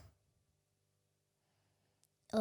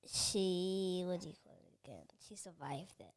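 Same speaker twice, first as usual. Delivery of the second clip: whispering; 4 semitones lower, 11 dB quieter than usual. Real, not acted. Child whispers aside.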